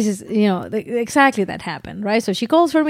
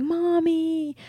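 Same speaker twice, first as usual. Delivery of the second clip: higher, softer little call; child calling mommy; 7 semitones higher, 5 dB quieter than usual. Retold or imitated. Baby calls mom at night, imitated childlike call. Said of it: "she would be like".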